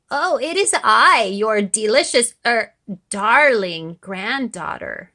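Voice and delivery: put-on falsetto wolf faking a sweet girl; wolf's high voice. A narrator's reading. Wolf pretending to be granddaughter, high and loud. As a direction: squeak it up high and loud, fooling Granny. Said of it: "said the wolf in a high voice".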